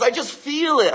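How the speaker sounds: retold tearful reply, high voice; imitating tearful wife. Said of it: "She's in tears and crying".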